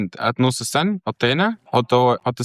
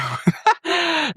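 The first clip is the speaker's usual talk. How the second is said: laugh. Real, not acted. Laughing mid-calculation.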